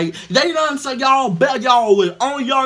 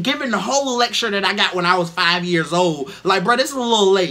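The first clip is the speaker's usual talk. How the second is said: ordinary delivery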